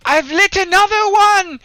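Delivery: very high, loud cry of alarm; shouting. Acted. Erky calls out to the party, shouting. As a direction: shout it out high-pitched at full voice. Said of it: "you can hear him shout".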